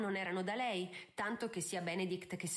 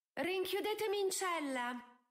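high, melodramatic martyr's cry; sighing exclamation; 11 semitones higher than usual. A narrator's reading. Daughter begging to be locked up, dramatically sighing. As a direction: offer yourself up like a tragic heroine. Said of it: "esclamò Posy con un sospiro".

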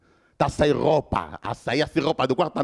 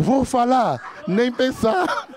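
slow stunned thought; 7 semitones higher, much slower than usual. Retold or imitated. Narrator's inner thought.

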